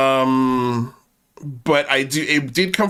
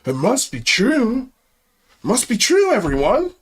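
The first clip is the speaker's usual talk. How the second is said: mock gullible; 5 semitones higher than usual. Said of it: "we just have to go".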